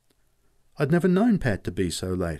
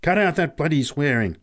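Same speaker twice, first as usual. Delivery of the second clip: louder, higher sharp rebuke; angry snap; 6 semitones higher, 5 dB louder than usual. Acted. Pat scolding the boy for swearing, angry snap. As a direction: slap the boy down with it. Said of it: "snapped Pat angrily".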